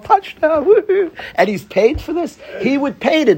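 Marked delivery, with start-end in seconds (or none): 0.0-1.1 high-pitched touchdown whoop; gleeful, high little victory cry; celebrating player. Acted. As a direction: cheer like a player who just scored. Acting out football player celebrating.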